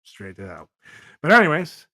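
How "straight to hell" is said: much softer, lower muttered echo; quiet repeat. Real, not acted. Damning pedos a second time, softly to himself. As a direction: let it fall away under the breath.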